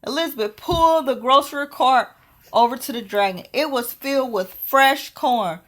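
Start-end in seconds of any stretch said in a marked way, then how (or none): none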